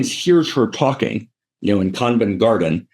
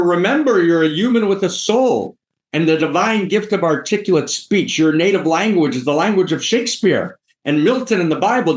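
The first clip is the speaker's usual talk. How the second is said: high-pitched oratorical lecture to a pupil; revolted Higgins; 6 semitones higher than usual. Retold or imitated. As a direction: declaim it grandly, pitched up. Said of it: "you know, revolted".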